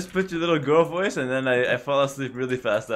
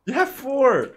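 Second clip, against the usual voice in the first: indignant; 9 semitones higher, 5 dB louder than usual. Real, not acted. Protesting in game argument.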